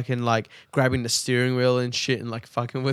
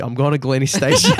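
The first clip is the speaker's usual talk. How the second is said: laughing; 9 semitones higher, 4 dB louder than usual. Real, not acted. Punchline of his own prank.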